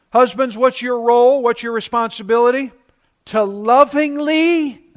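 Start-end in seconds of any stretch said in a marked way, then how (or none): 3.3-4.8 lovingly drawn out slowly and pitched high; stress on lovingly. Real, not acted. Preacher telling husbands how to lead, lovingly stressed. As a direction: lean hard on "lovingly", stretching it high. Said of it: "I emphasize the word lovingly".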